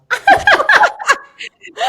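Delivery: loud high laugh; burst of laughter. Real, not acted. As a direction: laugh out loud, caught off guard. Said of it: "hair in my mouth".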